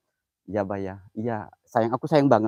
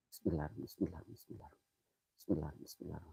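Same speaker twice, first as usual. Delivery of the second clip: much softer, low murmured bismillah; hushed prayer; 7 semitones lower, 20 dB quieter than usual. Real, not acted. Host entering the haunted spot, murmuring a prayer softly. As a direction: pray under your breath.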